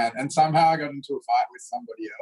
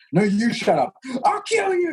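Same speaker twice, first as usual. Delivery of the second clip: mock escalating shriek; 13 semitones higher than usual. Retelling a kids' sibling fight to a class, mock tearful shriek. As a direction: ham up a kid losing it in a sibling fight.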